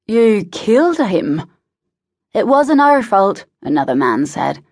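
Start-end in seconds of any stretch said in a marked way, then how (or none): 0.1-1.4 higher and slow, reeling; can't believe what he heard; stunned disbelief. A narrator's reading. Stunned reaction to death news. 2.3-3.4 pitched high and louder, pleading; a henchman protests to his angry lord; defensive protest. A narrator's reading.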